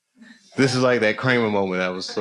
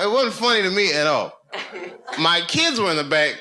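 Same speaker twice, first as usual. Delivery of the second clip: ordinary delivery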